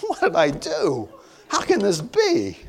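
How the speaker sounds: high, slow, half-laughing bewilderment; giggling as if losing your mind; laughing disbelief. Retold or imitated. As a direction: ask it through laughter, baffled.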